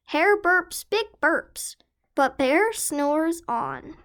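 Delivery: usual speaking voice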